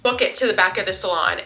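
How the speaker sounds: ordinary delivery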